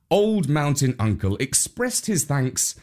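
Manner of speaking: usual speaking voice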